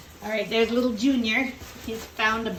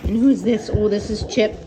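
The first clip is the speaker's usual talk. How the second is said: ordinary talk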